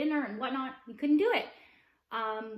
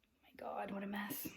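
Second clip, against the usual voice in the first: very soft whisper to herself; whispered aside; 2 semitones lower, 12 dB quieter than usual. Real, not acted. Vlogger to herself between takes, whispered aside. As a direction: whisper it under your breath.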